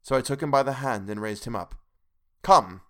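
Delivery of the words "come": low, loud, sharp "Come"; doctor halting the madman's plea, stern loud command. A narrator's reading. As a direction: cut him off with a firm "Come". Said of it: "I said sternly".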